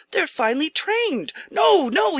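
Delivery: high, loud shocked protest; astonished protest. Acted. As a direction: rise up in alarm at the waste. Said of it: "astonished guest".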